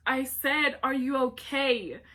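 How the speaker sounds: mock-angry, loud, drawn-out repeat; mad mom. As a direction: demand it slowly, like an annoyed mother. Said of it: "she gets mad at me".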